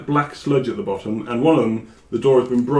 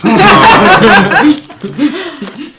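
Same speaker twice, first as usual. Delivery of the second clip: loud, high helpless laugh; long, loud cackling laugh; burst of laughter; 11 semitones higher, 11 dB louder than usual. Real, not acted.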